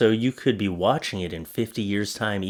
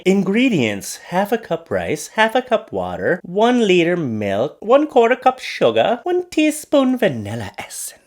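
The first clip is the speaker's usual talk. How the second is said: mock recipe read; 8 semitones higher than usual. Mock-reading a recipe post.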